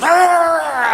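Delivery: high, loud snarling bark-roar; dog snarling at a passerby; dog attack growl. Pretend. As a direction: lunge with a growl. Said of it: "he goes".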